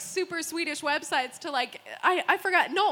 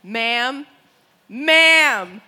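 drawn-out calls, growing louder; insistent interrupting; 2 semitones lower, 8 dB louder, much slower than usual. Retold or imitated. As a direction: try to get a hysterical customer's attention. Desk lady trying to interrupt her.

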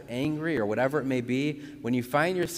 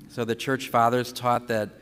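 normal talking voice

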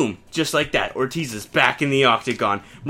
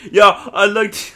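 higher, louder smug fighter boast; cocky McGregor; 7 semitones higher, 7 dB louder than usual. Acted. McGregor taunting on TV, cocky and loud. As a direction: brag it into the mic, laughing.

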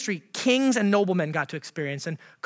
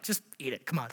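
weary parent; 7 semitones lower, 11 dB quieter than usual. Retold or imitated. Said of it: "like, God, like, with a toddler".

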